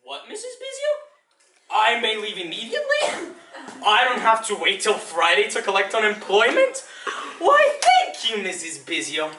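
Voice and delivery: high squeaky questions, then exaggerated thanks; mock delighted surprise. Fired librarian answering his supervisor, mock delighted surprise. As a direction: play up the sarcastic gratitude.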